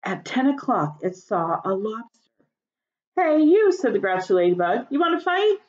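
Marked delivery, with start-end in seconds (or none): 3.2-3.7 loud, high, challenging call. A narrator's reading. Grouchy ladybug calls out. 4.9-5.6 high, pushy taunting challenge; challenging. A narrator's reading. Grouchy ladybug challenges.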